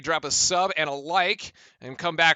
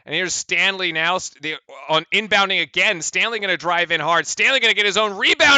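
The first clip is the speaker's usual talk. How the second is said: quick, rising basketball call; live play-by-play; 3 semitones higher, 4 dB louder than usual. Real, not acted. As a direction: call the action as it unfolds.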